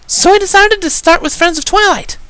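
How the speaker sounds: put-on very high, eager little-girl voice; bouncy filly. Little sister chimes in for the guest, high and bouncy. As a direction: chirp it with a bounce. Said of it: "added with a small bounce".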